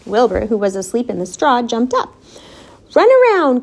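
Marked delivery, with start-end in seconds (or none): 2.9-3.6 high, loud command. A narrator's reading. Charlotte orders the pig into action, commanding. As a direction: give a brisk order, voice raised. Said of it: "commanded Charlotte".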